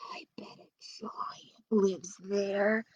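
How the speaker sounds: slow, wary whisper. Acted. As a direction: whisper it, afraid to be heard. Jack fearfully guesses.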